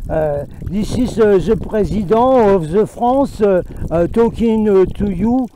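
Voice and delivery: put-on slow, loud French-accent English, lightly done; mock French-accented English. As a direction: play the president in broken English. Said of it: "qui parle anglais dans des autres pays". (imitation légère de l'anglais à l'accent français du président)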